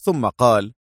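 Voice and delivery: usual speaking voice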